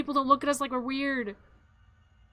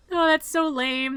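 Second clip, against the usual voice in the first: ordinary talk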